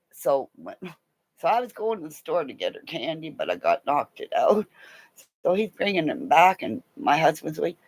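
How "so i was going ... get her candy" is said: usual speaking voice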